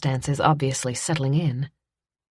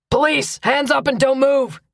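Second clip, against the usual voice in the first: loud, high commanding shout; police shout; 9 semitones higher, 4 dB louder than usual. A narrator's reading. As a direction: bark the order across the yard.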